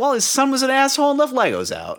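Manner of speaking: squeaky mock explanation; high silly voice. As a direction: pitch way up and play it silly. Silly retold excuse.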